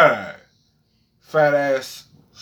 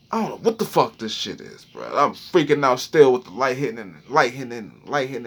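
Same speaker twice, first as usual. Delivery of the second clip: usual speaking voice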